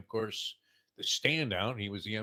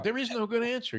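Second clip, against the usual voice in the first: ordinary delivery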